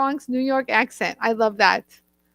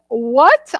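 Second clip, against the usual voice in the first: imitated shocked "what", rising and louder; astonished reaction; 5 dB louder than usual. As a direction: act out a stunned friend. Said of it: "Francesca's mouth dropped".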